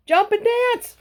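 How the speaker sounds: very high crowing rooster cry; rooster calling. A narrator's reading. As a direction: crow it like a rooster. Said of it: "said the rooster".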